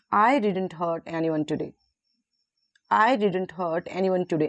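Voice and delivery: "I" given the main weight, "today" falling; stress on I. Shown as a demonstration. Teacher shows who didn't do it, stress on "I". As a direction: lean on "I", let the rest fall. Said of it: "I पे focus डाला है".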